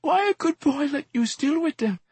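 very high, anguished pleading; sobbing. A narrator's reading. Exhorter standing over the fallen man, sobbing high-pitched plea. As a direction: wail it as a tearful reproach.

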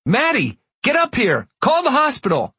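high panicked shout up the stairs. Acted. Father calling his wife in alarm, panicked high shout. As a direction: call for help, pitch shooting up. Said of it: "shouting down the stairs".

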